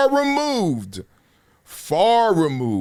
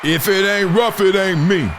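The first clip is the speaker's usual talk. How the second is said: higher, louder slogan; 9 semitones higher, 6 dB louder than usual. Acted. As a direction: punch the slogan out loud and high. Louder first take of slogan.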